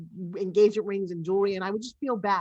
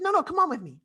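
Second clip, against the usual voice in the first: higher, louder imitation of a coaxing daughter; insistent daughter; 8 semitones higher, 5 dB louder, faster than usual. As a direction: urge mom along, quoting lightly. Retells daughter urging her into store.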